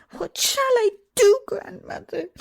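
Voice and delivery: high, loud sobbing cry to grandmother. A narrator's reading. Princess turns to her grandmother, sobbing high and loud. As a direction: wail it out through sobs. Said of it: "sobbed the princess".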